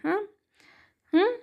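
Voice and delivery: ordinary delivery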